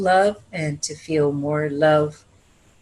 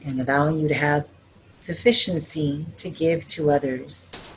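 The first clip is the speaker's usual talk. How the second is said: blessing prayer; 2 semitones lower than usual. Acted. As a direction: bless the room gently, like a healer. Leads a closing blessing.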